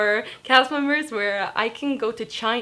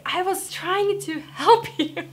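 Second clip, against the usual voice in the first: hurt protest; 9 semitones higher, 4 dB louder, slower than usual. Retold or imitated. Customers hang up on her.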